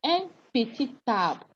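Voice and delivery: "un petit arbre" with T linked, peti-tarbre; teacher model of "un petit arbre", arbre starting with t; with liaison. Shown as a demonstration. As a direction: carry the T of "petit" onto "arbre". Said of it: "when you do liaison here, it gives you".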